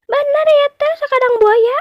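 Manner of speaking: very high doubtful mouse deer voice; mouse deer questioning. Acted. Mouse deer asks the crocodile if it is true, very high voice. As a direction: check it with mock suspicion. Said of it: "pecang nanya".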